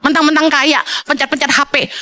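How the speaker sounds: usual speaking voice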